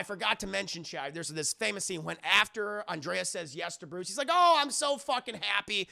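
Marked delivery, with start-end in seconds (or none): 4.3-5.9 high, loud burst of joy; hammed-up happy exclamation; mock elation. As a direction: gush as an overjoyed fiancée. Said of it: "they're so happy. They're so excited".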